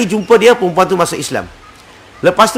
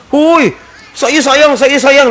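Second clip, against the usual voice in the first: high, eager mock protest; 8 semitones higher, 4 dB louder, much slower than usual. Preacher mimics the principal insisting he loves Jesus, high and eager. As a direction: squeal it out like someone insisting. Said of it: "dia kata dia sayang".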